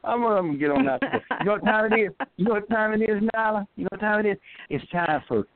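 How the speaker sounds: high-pitched, hurried comic voice; playful put-on voice. Caller teasing the host about leaving, in a high put-on voice. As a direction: put on a high, silly voice to sign off.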